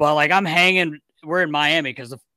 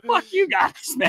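laughing mock curse; 13 semitones higher than usual. Laughing reply to teammates' prank.

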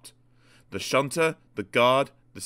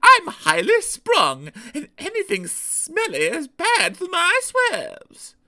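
very high, loud, drawn-out diesel voice; fussy Daisy; 8 dB louder, slower than usual. Acted. Daisy objecting to the smelly engine shed, high and self-important. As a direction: complain grandly in a high, fussy voice.

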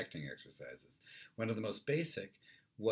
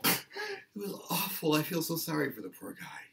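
distraught witness; 11 semitones higher, 7 dB louder than usual. Acted. Second witness pities the poor driver, hammed-up distress. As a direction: wail with pity for the driver. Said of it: "Then somebody else says".

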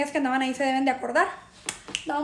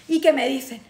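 ordinary talk, nothing marked

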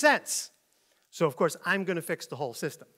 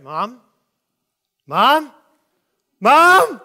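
calls rising higher and louder; gradually building panic; 5 semitones higher, 14 dB louder, much slower than usual. Acted. Lost child searching for mom, building panic. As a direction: start small, build to alarmed calls. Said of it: "it goes gradually".

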